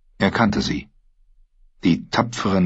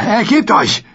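high, loud furious order; shouting demand; 14 semitones higher, 4 dB louder than usual. Acted. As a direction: yell it into his face. Brienne drückt ihn unter Wasser. (laut geschrien)

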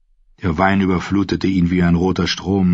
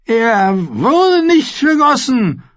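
put-on much higher, louder and slow, a bratty young king; petulant boy king; 4 dB louder, slower than usual. A narrator's reading. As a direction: drag it out like a spoiled royal child. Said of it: "sagte Joffrey".

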